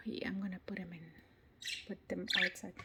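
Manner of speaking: whispered aside. Real, not acted. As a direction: drop to a whisper.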